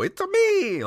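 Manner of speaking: high squeaky Italian Mario impression. Mocking what Pratt should do, in a high Mario voice. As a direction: pop up high into a cartoon Mario. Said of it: "He's not doing, you know".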